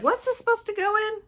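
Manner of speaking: high-pitched imitation of puzzled self; mock puzzlement. Vlogger imitating herself forgetting later, high puzzled voice. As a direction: squeak it out like you're baffled. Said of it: "I'll be like".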